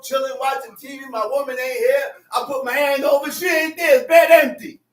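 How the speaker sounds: usual speaking voice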